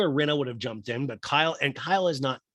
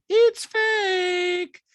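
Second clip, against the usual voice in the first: loud, high squealed accusation; mock castmate falsetto; 6 dB louder than usual. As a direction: insist in an exaggerated squeaky impression. Acted Diana insisting in quarrel.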